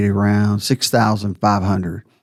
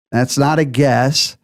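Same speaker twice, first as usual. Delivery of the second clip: normal talking voice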